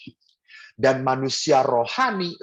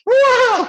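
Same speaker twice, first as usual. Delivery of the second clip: piercing high, loud mock scream; teriakan histeris tiruan; 9 dB louder than usual. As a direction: imitate hysterical children shrieking. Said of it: "anak-anak langsung bahkan sampai histeris teriaknya". (tiruan anak menjerit histeris)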